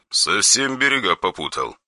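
soft, cold menace; quiet threatening caller on the phone; dry harsh voice. Acted. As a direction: threaten without raising the voice. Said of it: "сухой, нетерпящий препирательств голос".

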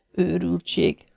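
soft, high-pitched musing; inner thought. A narrator's reading. Maisie privately scolding herself for coming along, in a soft voice. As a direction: think it aloud, softly and high.

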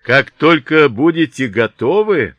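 high, loud call to the enemy; shouting. A narrator's reading. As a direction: shout it out as a challenge. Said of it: "снова закричал он".

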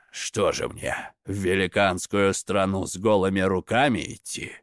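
low, frowning, grumbled question; glum objection. A narrator's reading. Svarog asks about going in bare-handed, glumly objecting.

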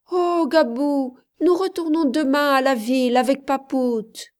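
put-on high, loud child voice; excited little girl. A narrator's reading. Child telling Gabou about tomorrow's trip, excited. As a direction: announce it eagerly like a small child. Child Mayotte tells her news.

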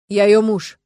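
loud, insistent declaration; forceful assertion. A narrator's reading. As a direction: assert your right to stay, loudly. Man loudly asserting himself to doctor.

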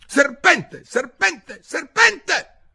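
high, loud repeated cries, peaking last; very high, loud bird shriek; pigeon's alarmed screech. A narrator's reading. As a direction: screech the accusation in bursts.

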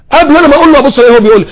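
animated storyteller; excited rush. Real, not acted. Excited fast telling.

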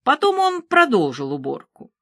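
ordinary delivery

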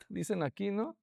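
ordinary delivery

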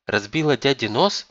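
incredulous follow-up question; stunned question. A narrator's reading. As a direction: check the shocking news. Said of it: "потрясённо переспрашивает у мамы Сунок".

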